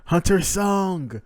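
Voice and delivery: acted panicked yell for the fainted hunter; screaming. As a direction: shout his name across the room in alarm. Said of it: "We are just screaming".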